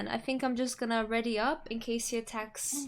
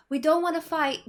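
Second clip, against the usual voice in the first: raised, louder plea to stand down; in-character appeal; 4 semitones higher, 6 dB louder than usual. Acted. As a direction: call it out to the stranger. Player speaks as character to foe.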